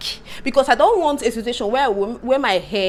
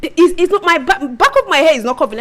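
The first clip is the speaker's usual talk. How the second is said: frustrated outburst; 8 semitones higher, 8 dB louder than usual. Real, not acted. Wig won't cover her head.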